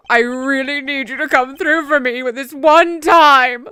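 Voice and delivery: high, building, exaggerated begging; mock desperate plea. Prosecutor needing the detective to come through, hammed-up pleading. Acting Edgeworth pleading for backup.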